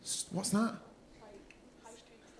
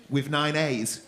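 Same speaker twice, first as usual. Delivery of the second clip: normal talking voice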